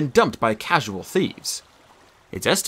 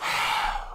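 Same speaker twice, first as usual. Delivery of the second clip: breathy resigned sigh; weary sigh; 7 dB quieter than usual. Acted. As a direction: let out a tired, put-upon sigh. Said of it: "Miles sighed".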